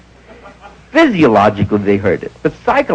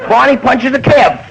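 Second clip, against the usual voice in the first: normal talking voice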